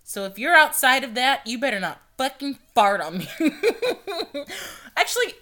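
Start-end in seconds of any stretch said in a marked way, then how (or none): none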